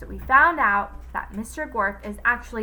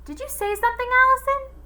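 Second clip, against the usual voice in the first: much higher voice; puzzled cook; 7 dB louder, faster than usual. A narrator's reading. Cook asking from behind the door, high. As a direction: call through the door, curious. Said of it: "asked Miss Mush".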